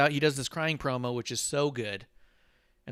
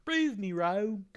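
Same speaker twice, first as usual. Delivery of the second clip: mock catchphrase; 8 semitones higher, much slower than usual. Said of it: "catchphrase in mock voice".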